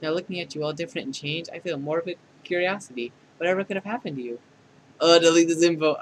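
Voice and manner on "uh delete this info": loud. Acted. Panicked request to the AI.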